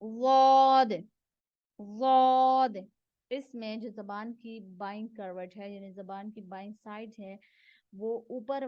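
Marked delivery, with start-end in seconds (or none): 0.0-2.8 full-mouthed "वाद"; letter demo. Shown as a demonstration. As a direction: model "वाद" for learners. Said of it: "ये है".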